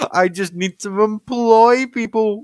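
slow, high scheming villain; mad scientist. Pretend. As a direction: relish the evil plan. Gloating villain plan.

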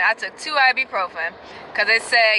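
ordinary talk

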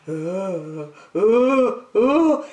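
rising wheezy uh uh uh before a sneeze; wheezing build-up. A narrator's reading. Dragon winding up to sneeze, rising gasps. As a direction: build toward the sneeze with gasping uhs. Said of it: "he started to wheeze".

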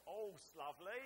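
broad Essex "Horse" with dropped R, called out loud; Essex accent. Shown as a demonstration. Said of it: "Get it right".

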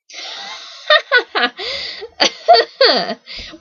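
high, loud, mocking cackle; teasing laugh. A narrator's reading. Boy teasing Steffi about his name, high mocking laugh. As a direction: laugh high and long in their face. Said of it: "he teased".

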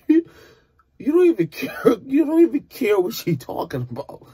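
high, loud burst of disbelief; exasperated amazement. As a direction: blurt it out high, overwhelmed by the singing. Exasperated reaction to singer's vocals.

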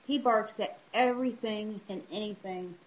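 ordinary delivery

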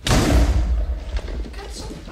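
high, loud, drawn-out angry yelling; explosive italian cursing. Real, not acted. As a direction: blast the curse at the enemy. Enemy player approaching.

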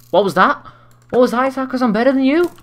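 high, fast, gloating taunt; mocking taunt. Real, not acted. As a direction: needle the loser. Taunting beaten opponent.

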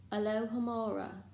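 plain reading voice

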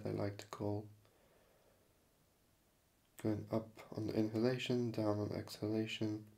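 soft, calm meditation guide with long pauses; hushed, even-pitched instruction, leaving space between phrases; guided meditation. Acted. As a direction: gently lead them into awareness of the breath. Leads a breathing meditation.

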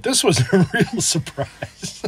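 high, slow, through a laugh; amused, drawn out and laughing. Real, not acted. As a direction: let real laughter break into the words. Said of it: "I'm laughing 'cause this place was".

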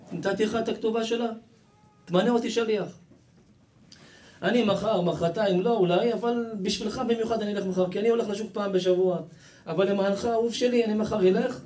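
high-pitched, insistent offer of help; eager offer. Acted. False friend offers to deliver the divorce, eager offer. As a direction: press the favour on him, pitched well up. Acted schemer offering to be messenger.

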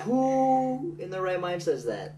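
high, loud, drawn-out disbelief; retold incredulous line, hammed up. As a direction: stretch WHO out long and high, mock-astonished. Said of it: "someone saying that, realizing".

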